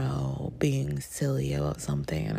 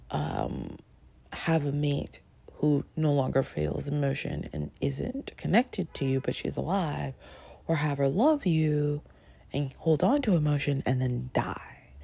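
normal talking voice

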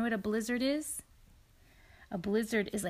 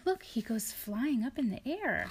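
ordinary delivery, nothing marked

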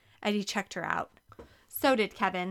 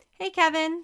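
bright, higher and louder hello; cheerful greeting; 8 semitones higher, 5 dB louder than usual. A narrator's reading. Barista greeting a regular customer, cheerful and upbeat. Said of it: "Stephanie answered cheerfully".

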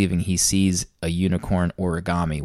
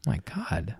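whispered; 3 semitones higher, 10 dB quieter than usual. Real, not acted. Shocked reaction to the snake scene.

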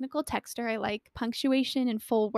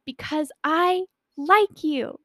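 raised, slow, exasperated confession; retold yelling; 6 semitones higher, 5 dB louder, slower than usual. Confessing on the phone mid-argument, mock angry yell. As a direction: blurt it out loud and slow. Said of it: "I'm yelling".

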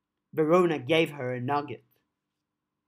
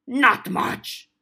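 goblin voice; 7 semitones higher, 6 dB louder than usual. Acted. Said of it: "still reaching".

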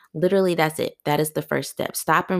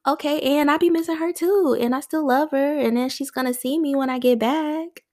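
much higher, cheeky talk-back; playful higher-pitched retort; sassy comeback; 11 semitones higher than usual. Pretend. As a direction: toss it back at the guilt with sass.

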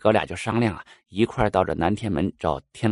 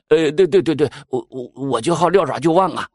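hasty agreement; 5 semitones higher than usual. Acted. Mouse hurriedly agrees with King Yama, eager stammer. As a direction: jump in to agree before anyone else speaks. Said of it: "就赶紧说".

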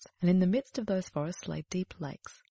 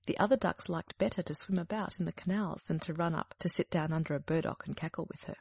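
ordinary delivery, nothing marked

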